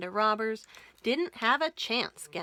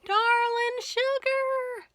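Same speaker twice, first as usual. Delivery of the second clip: high, slow, drawn-out wail; Lil's high slow wail from the upstairs window; wailing wife; 12 semitones higher, 3 dB louder, slower than usual. Acted. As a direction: wail after him from the window. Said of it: "wailed Lil".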